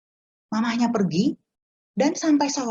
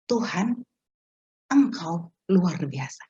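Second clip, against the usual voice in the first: awed praise; 3 semitones lower, much slower than usual. Retold or imitated. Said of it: "itu saya langsung".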